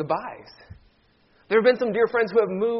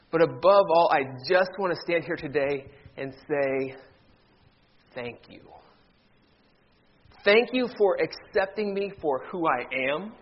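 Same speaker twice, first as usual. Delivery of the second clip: ordinary talk, nothing marked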